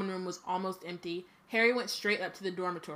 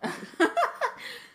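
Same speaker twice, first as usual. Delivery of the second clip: high airy giggle; high breathy laugh; 14 semitones higher than usual. Real, not acted. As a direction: burst into a high wheezing laugh. Burst of laughter.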